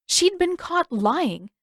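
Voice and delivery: ordinary delivery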